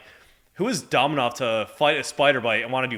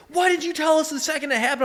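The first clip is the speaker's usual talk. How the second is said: high put-on shouting voice; mock drill sergeant yell. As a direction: bark it like a furious drill sergeant, hammed up. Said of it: "he's like".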